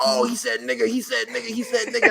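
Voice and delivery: high, excited repeated exclaiming; excited chorus. Retold or imitated. People the next morning reacting to TV, excited. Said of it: "people like".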